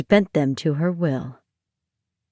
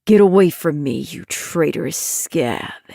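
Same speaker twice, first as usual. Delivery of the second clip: hissed contempt; 3 semitones lower than usual. Acted. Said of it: "The nightmare hissed".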